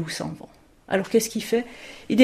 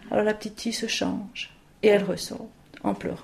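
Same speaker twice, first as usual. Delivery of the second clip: ordinary delivery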